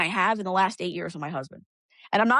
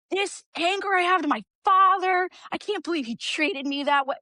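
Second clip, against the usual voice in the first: quoting a woman's outburst; 9 semitones higher than usual. Retold or imitated. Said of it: "And they're like".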